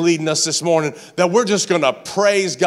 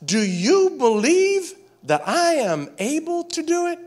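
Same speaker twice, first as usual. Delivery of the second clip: raised, stressing YOU in "Do you believe"; key phrase stressed; 8 semitones higher than usual. Shown as a demonstration. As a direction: lean hard on "you". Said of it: "here's a key phrase".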